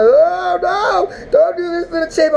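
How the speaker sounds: high, crying plea; mock wailing. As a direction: wail like a heartbroken fan. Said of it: "And I was like".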